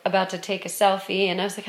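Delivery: ordinary talk, nothing marked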